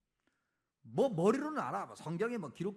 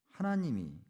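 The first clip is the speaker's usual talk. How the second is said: ordinary talk, nothing marked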